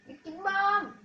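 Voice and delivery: loud, high shouted call of the stunt; hyped catchphrase shout. Real, not acted. Gamer shouting his stunt name to viewers, loud and high. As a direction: yell the catchphrase with glee.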